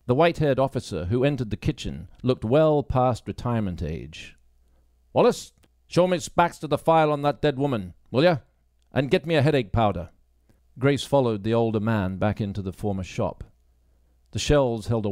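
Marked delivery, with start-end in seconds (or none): 5.1-10.1 a lightly raised, blunt sergeant's voice; dismissive police sergeant lecturing a new recruit; gruff sergeant. Acted. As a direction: talk down to her as the stern boss. Baines orders.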